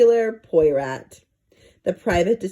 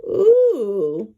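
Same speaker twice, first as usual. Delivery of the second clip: squeaky ooh; 3 semitones higher, 4 dB louder than usual. Acted. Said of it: "high-pitched squeak".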